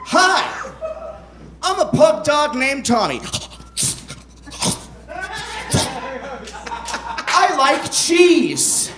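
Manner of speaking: a happy pug introducing himself, slowly savoring it; excited pug dog. Acted. Author reads Tommy the pug to the audience, excited. Reads pug's lines aloud from book.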